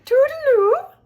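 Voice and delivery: a chirpy Missus Peacock sing-song farewell; high fluttery lady. A narrator's reading. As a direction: trill it like a fussy, delighted lady. Missus Peacock says goodbye.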